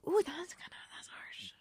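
breathy hushed ooh, that's harsh; whispered reaction. Real, not acted. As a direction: whisper it as if wincing.

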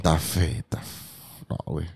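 slurring, can't get the word out. Real, not acted. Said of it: "ya ando bien pedo".

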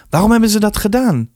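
high, concerned, irritated question; worried annoyance. A narrator's reading. Mother questioning beaten son, worried and annoyed. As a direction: demand a reason, worried and irked. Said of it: "Naast bezorgdheid toonde mevrouw Westervoort ergernis".

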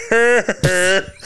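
weird high villain laugh; Voldemort. Retold or imitated. Imitating the last movie's villain, awkward laugh. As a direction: let out an exaggerated, awkward evil laugh. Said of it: "Voldemort goes".

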